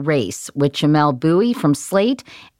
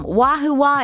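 cheering; 10 semitones higher than usual. Real, not acted.

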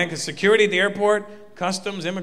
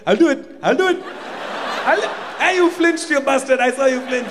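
joker terrorist; 7 semitones higher than usual. Retold or imitated. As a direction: tease like a prankster with his thumb on the button. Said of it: "the one joker terrorist guy".

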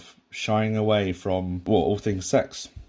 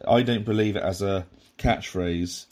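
normal talking voice

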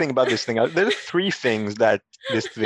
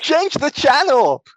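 high, loud, drawn-out yell; hammed-up quoted shout; Zangief shout; 12 semitones higher, 5 dB louder, slower than usual. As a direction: holler it slowly like a panicked movie henchman. Calling out Zangief's movie line.

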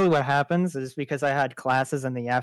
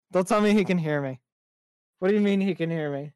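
ordinary delivery

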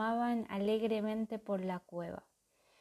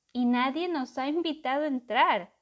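booming terrible voice; 5 semitones higher, 7 dB louder than usual. A narrator's reading. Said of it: "retumbó una voz terrible".

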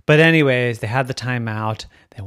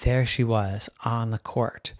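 ordinary delivery, nothing marked

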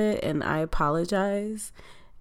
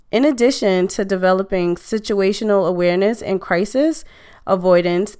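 upbeat sponsor read, a bit louder; 2 semitones higher, 5 dB louder than usual. Acted. Host reads sponsor ad to listeners, upbeat ad voice. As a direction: sell the training class to listeners.

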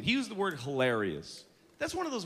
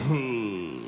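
slack mumbled who; 9 semitones lower than usual. Retold or imitated. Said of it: "It just slid out".

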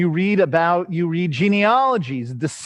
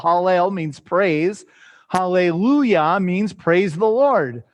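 usual speaking voice

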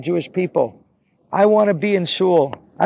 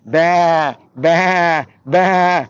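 loud, higher, separate bleats; sheep bleating; 4 semitones higher, 5 dB louder, much slower than usual. Acted. Boy offering animal sounds to God, loud bleating. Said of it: "the boy continued and said".

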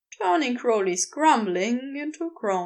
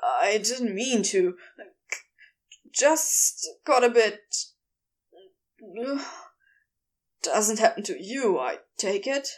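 embarrassed; 3 semitones lower than usual. A narrator's reading. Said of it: "a deep blush spreading across his cheeks".

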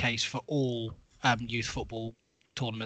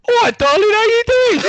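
much higher and louder, hammed-up London accent; London geezer accent; 16 dB louder than usual. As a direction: belt out a mock London greeting. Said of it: "have a go at mine".

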